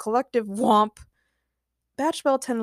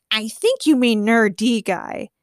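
slow, louder villain voice; evil smile; 5 dB louder, slower than usual. A narrator's reading. Nerdy Guy corrects his enemies, evil smile. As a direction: correct them with smug menace, slowly. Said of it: "he said with an evil smile".

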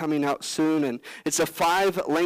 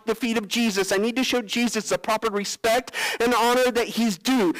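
higher, hurried, keen apology; eager, urgent apology; 6 semitones higher than usual. Pretend. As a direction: rush to make amends. Preacher acting Simon's apology.

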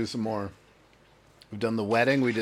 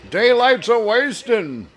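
slow, higher, louder folksy line; drawn-out old salt; 8 semitones higher, 6 dB louder, slower than usual. Retold or imitated. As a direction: call it out like an old sea captain. Acted movie line.

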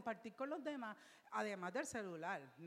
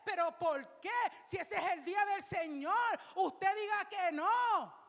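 insistent louder retake; louder insistent question; 8 semitones higher, 10 dB louder than usual. Retold or imitated. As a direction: demand an answer, rising to a shout. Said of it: "Y yo digo".

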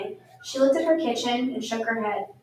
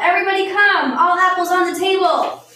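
high, loud summons; calling out; 7 semitones higher, 6 dB louder than usual. A narrator's reading. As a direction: call everyone in to the table. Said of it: "Later she called".

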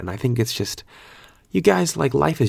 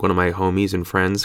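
ordinary talk, nothing marked

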